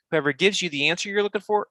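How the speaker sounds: ordinary talk, nothing marked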